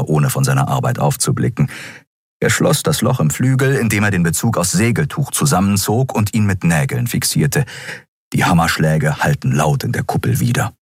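ordinary delivery, nothing marked

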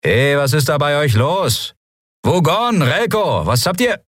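high-pitched urgent call. Acted. Hailing Wugon and Relko to ask what's wrong, high urgent call.

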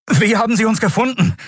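high, startled call; voiced in a high startled cry; alarmed shout. Sudden encounter while fleeing.

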